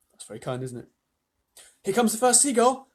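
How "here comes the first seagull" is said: ordinary delivery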